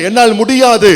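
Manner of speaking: high, loud cry of "I can't"; loud refusal. Acted. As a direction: Moses crying out that he can't. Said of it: "Moses refusing God".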